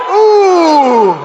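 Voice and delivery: exaggerated drawn-out exclamations of amazement; practised awe. Shown as a demonstration. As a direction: lead the room in gasps of wonder. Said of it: "You might as well practice it right now".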